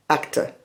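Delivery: English "actor" ending in a weak schwa, like "acta". Shown as a demonstration. Teacher explains the second vowel, "acta" with a weak schwa ending. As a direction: say "acta" with the last vowel short and unstressed. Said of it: "un sonido más parecido a la E o la A".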